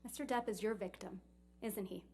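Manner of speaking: normal talking voice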